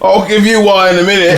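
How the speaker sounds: high, loud play threat; shouted mock threat. Acted threat in the kitchen story.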